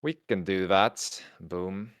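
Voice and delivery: ordinary talk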